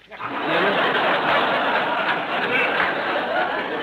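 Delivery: breathy unvoiced burst of laughter; real laughter at his own wisecrack; laughing at own joke. Real, not acted. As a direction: laugh hard at the gag.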